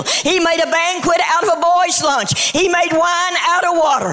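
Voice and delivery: high, rising preacher's cadence building to a shout; rousing church sermon climax; full-force preaching. Acted. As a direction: preach it, climbing with each line. Preaching the miracles list.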